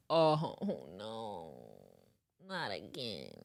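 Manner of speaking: very soft, low groan of dismay; hushed dread. Retold or imitated. Driver hearing his door tugged, hushed dread. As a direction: sink into a tiny, dreading voice. Dread as she returns to car.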